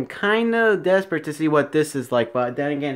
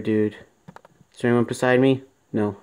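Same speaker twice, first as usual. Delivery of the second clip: ordinary talk, nothing marked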